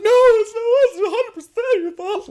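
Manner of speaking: high, loud mock girlfriend voice; imitating girlfriend blaming him; insistent girlfriend.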